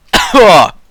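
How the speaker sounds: one loud, sudden cough. Real, not acted. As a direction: cough hard into the mic.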